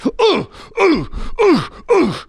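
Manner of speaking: very high yelping oohs; loud high oohs. Shown as a demonstration. Boxer hitting the bags, yelping high oohs. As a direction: yelp high and loud at every hit. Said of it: "When I hit the bags".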